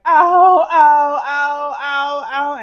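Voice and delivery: high, loud repeated ows, easing in volume; acted burst of pained yelps; screaming in pain. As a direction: cry out over and over, re-enacted. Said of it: "screaming in my head".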